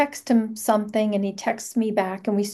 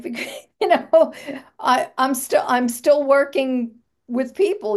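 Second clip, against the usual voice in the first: laughing through the words, a bit louder; 4 semitones higher, 3 dB louder than usual. Retold or imitated. Joking to Joe about who feeds the animals, laughing. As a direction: joke while still laughing.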